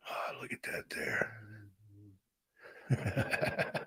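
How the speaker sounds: breathy, moved whisper. Real, not acted. Moved by the slide on screen, whispering to himself. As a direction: hush it out, touched.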